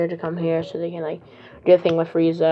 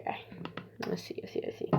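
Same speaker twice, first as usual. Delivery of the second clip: hushed whisper, repeating to himself; quiet whispered musing; 18 dB quieter than usual. Retold or imitated. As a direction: whisper it under the breath. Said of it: "He's like".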